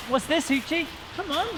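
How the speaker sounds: high, soft dog-coaxing voice; coaxing pet talk. Real, not acted. Owner asks Hoochie what this is, high coaxing voice. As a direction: coax the dog along, pitch climbing, voice kept soft.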